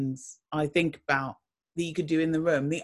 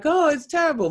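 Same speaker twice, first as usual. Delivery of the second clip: mock whinge; 9 semitones higher, 4 dB louder than usual. Mock complaint about her job.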